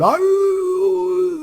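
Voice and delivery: high howl like a distant coyote. Retold or imitated. Mimicking a coyote far off in the hills, howling. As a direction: throw a long howl into the hills. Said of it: "a coyote ... going".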